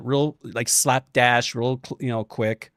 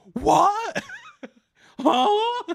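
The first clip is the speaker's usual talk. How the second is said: much higher, disbelieving squeak; high stunned what with a laugh; baffled what. Retold or imitated. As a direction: react like you can't believe your ears. Stunned by theater applause.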